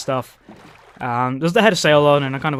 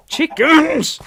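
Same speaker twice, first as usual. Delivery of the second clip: much higher, loud call; calling the chickens; 12 semitones higher, 5 dB louder than usual. Real, not acted. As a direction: yell out to the flock.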